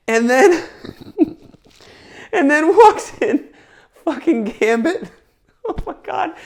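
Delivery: high, loud, cracking up between words; real laughing excitement. Real, not acted. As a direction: barely get it out through giggles. Gleeful recall of Gambit's entrance.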